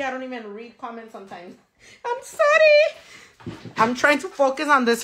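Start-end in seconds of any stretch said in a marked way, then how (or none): 2.0-3.0 tiny, very high-pitched sorry; playful apology. Real, not acted. Streamer apologizing to viewers for missed comments, high squeaky voice.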